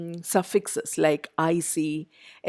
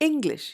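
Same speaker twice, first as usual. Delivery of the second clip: "English" with a high ENG, then falling; ENG-lish, weight on the start of "English"; first-syllable stress; 6 semitones higher than usual. Shown as a demonstration. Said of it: "first syllable".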